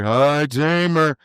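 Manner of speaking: very slow, stretched-out coaching call; Speedy impression. Mentor's voice in his head at the box, slow drawn-out impersonation. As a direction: imitate the mentor's drawn-out holler. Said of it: "I can just hear".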